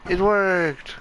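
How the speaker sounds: high, loud cry of relief; triumphant. Real, not acted. Game trick succeeds.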